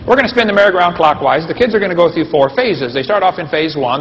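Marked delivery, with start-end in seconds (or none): none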